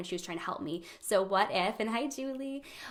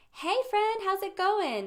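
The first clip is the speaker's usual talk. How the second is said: high, loud call down a hole; oblivious high-pitched cheery passer-by; breezy sympathy bear; 11 semitones higher, 5 dB louder, slower than usual. Acted. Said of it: "sympathy walks by at the top of the hole".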